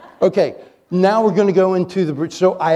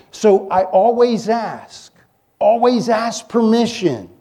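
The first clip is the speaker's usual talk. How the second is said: ALWAYS slowed and leaned on, higher; stress on always; 4 semitones higher, slower than usual. Acted. Preacher urging the congregation, slow stress on always. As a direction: drag out ALWAYS to drive it home.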